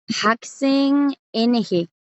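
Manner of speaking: Haksen said slowly, ending on a clear ng; pronunciación lenta. Shown as a demonstration. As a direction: stretch out Haksen for the class. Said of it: "La última es una N G". (Haksen pronunciado despacio con ng final)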